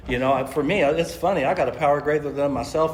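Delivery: usual speaking voice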